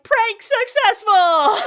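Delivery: high, loud, drawn-out mock announcement; triumphant shout. Boys in the hallway revealing the prank, triumphant shout. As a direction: crow it out like a gleeful group.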